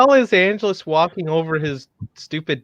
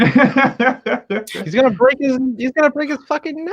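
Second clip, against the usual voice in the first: high-pitched words through laughter; laughing alarm; 6 semitones higher than usual. Real, not acted. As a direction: cry it out while cracking up. Laughing at risky stunt talk.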